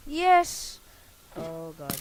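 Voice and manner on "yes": triumphant shout. Real, not acted. Winning a hit.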